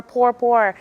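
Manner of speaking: usual speaking voice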